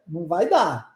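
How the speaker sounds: ordinary delivery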